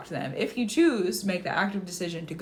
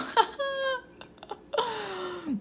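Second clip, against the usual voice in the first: laughing. Real, not acted. Streamer tickled by a viewer's comment, laughing.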